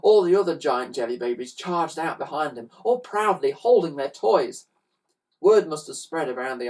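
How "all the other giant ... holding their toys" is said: plain reading voice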